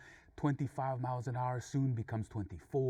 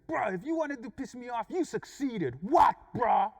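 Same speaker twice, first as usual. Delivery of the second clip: high-pitched rant building to a loud yell; angry challenge; 8 semitones higher, 7 dB louder than usual. Retold or imitated. Driver confronts the man he is passing, angry challenge. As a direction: build from heated complaint to shouted provocation. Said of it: "I'm looking at him like".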